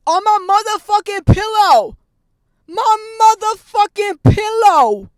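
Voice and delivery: furious scream. Real, not acted. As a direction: scream it out, outraged and grossed out. Screaming at cockroach on pillow.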